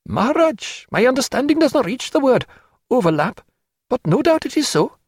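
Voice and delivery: put-on high-pitched child's voice, formal and earnest; porter's young child. Porter's child solemnly explaining the tigers to Stephen, high child voice. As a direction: answer up high like a small child.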